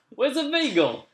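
very high, loud caricature New York accent; New Yorker accent. Shown as a demonstration. Brit improvising for friends, hammed-up New York accent. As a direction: squawk it as a cartoon New Yorker. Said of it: "all New Yorkers say".

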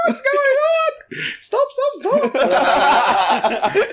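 very high, slow shrieking panic; mock panicked shriek. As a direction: shriek at them to stop, hammed up. Crew panicking.

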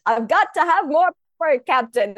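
high, loud attempt at a Scottish accent; a hammed-up Scotty with a Scottish accent; bad Scottish accent. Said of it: "and I can't do the Scottish accent".

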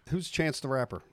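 usual speaking voice

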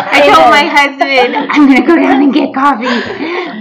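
bubbling over with real laughter; laughing. Real, not acted. Happy story, laughing while telling.

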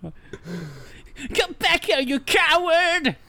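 high, slow, drawn-out hammy cry; hammy villain yell. Acted. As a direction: ham up a cowardly villain's challenge. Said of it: "says Tomorrow".

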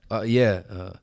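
usual speaking voice

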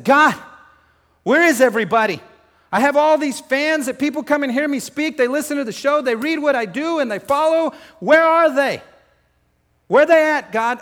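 high, loud, raw shout at God; yelling out, voice going raw; angry cry to God. Retold or imitated. Preacher reliving shouting at God in his car, furious. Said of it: "And I was mad".

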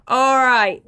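drawled mock northern all right; mock northern accent. Host repeating the greeting in mock northern accent.

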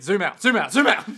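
mock panic. Acted panic at being overheard.

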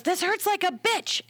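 high-pitched retold complaint; swearing and angry. Client during epidural, retold angry swearing. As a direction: imitate a pained, cursing patient, drawn out. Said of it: "my client is swearing and angry".

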